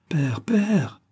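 put-on eager girlish calling for father; crying out. A narrator's reading. Girl trying to get busy father's attention, high cry. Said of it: "cria-t-elle".

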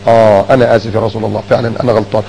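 soft, low, humble apology. Retold or imitated. A companion apologizing meekly to the Prophet, soft and low.

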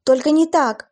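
loud, high tearful cry. Acted. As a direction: cry it out, breaking down. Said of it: "всхлипывая".

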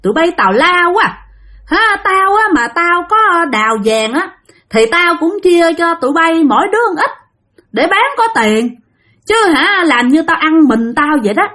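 high-pitched indignant scolding; drunken cursing. Acted. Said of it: "ông Sáu Xị này mới chửi".

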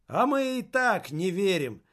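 shouting crowd. A narrator's reading. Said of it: "закричали в другом конце".

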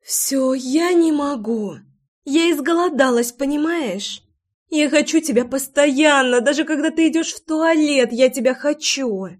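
high, desperate complaint of longing; moaning plea. A narrator's reading. Лой tells her lover she is starved for him, moaning high. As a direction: moan it out high, aching with want. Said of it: "Лой тут же согнулась пополам и простонала".

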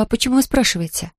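plain reading voice

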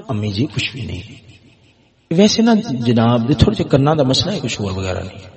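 low, hushed dismissal; son's soft reply. Acted. Son telling his elderly mother it is nothing, softly. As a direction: play down the worry very quietly.

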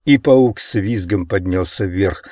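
usual speaking voice

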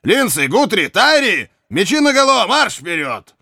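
high, ringing battle order; shouted command. Acted. Officer ordering his archers to draw swords, shouted command.

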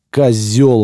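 indignant shout. A narrator's reading. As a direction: hurl it at the shooter. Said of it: "вознегодовал Пашка".

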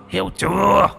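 high furious shout; ghost shout. A narrator's reading. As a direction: scream the question at her. Ghost yells at Hương.

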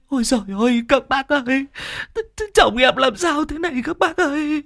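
high, tearful wailing; sobbing plea to the neighbours; wailing wife. Acted. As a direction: cry over her husband's body. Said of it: "rưng rưng nước mắt".

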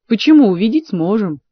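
ordinary delivery, nothing marked

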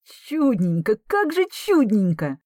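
can't believe his ears; disbelieving delight. Acted. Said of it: "не веря своим ушам". (изумлённая радость)

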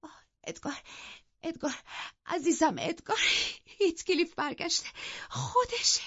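high, gasping, words in bursts; overjoyed and out of breath from the stairs; breathless and broken. A narrator's reading. Wife to her husband, breathless high gasping joy. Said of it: "نفس زنان ... بریده بریده گفت".

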